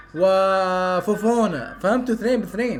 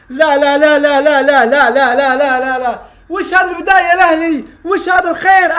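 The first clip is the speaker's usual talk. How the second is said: loud, high run of no, no; loud protest; 3 semitones higher, 7 dB louder than usual. Real, not acted. Fan yelling at Al-Ahli over bad start, loud protest. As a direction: shout your protest at the team.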